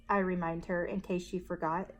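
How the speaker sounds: plain reading voice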